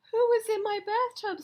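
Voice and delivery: high, loud startled cry; shocked outcry. Acted. Homeowner finding a seal in the bath, loud high shocked cry. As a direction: yelp it in shock.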